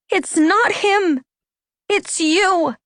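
high, slow, emphatic outburst; angry cry. A narrator's reading. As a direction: cry out angrily, pitched right up. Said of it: "Anna cried angrily".

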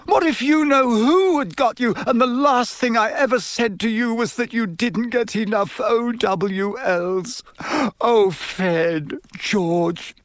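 high overwrought wail, slowing at the end; tearful, starting to sob. A narrator's reading. Mother to the twins she shouted at, tearful wail. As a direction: wail with guilt, close to sobbing. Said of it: "starting to sob".

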